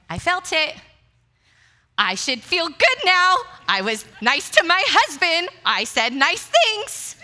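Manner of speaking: high, loud mock-wailing protest; hammed-up upset, pitch way up; exasperated wail. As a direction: whine loudly at being cheated. Acting out upset after call.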